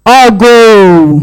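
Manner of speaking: long, full call-out; drawn-out call. Shown as a demonstration. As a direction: call it out to the room, stretching GO. Varied call for audience response.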